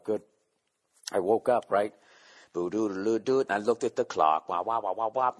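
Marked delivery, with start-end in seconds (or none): 2.5-5.3 imitated alarm buzz.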